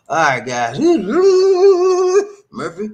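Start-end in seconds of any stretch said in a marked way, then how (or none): 0.8-2.4 loud, very high drawn-out squeal; hammed-up wail. Host reacting to viewer's shingles story, hammed-up wail. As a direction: let out a long mock wail.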